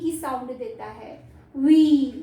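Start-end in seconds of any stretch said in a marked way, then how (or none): 1.6-2.1 वी loud, with a held "ee"; long E demo. Shown as a demonstration. Teacher showing the rule, वी with long E. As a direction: stretch वी out loudly. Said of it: "ये long ई".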